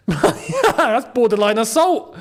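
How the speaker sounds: amused, drawn-out protest; laughing exclamation. Real, not acted. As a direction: laugh in disbelief at the tackle. Laughs at the foul.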